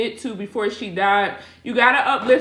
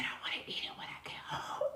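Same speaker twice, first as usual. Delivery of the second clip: shy whisper; 21 dB quieter, faster than usual. Retold or imitated. Niece's whisper imitated again.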